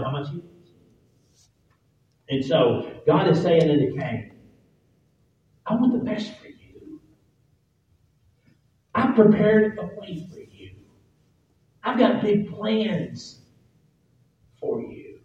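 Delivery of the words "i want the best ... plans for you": God's tender promise. Acted. God reassuring Cain that He has plans for him, tender. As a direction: coax him gently, like a loving father.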